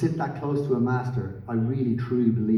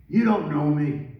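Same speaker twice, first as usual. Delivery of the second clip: louder, higher mock drunk outburst; put-on drunken dismissal, pitched up; drunk man's brush-off; 6 semitones higher, 5 dB louder, slower than usual. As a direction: imitate a drunk musician snapping back loudly. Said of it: "He was drunk".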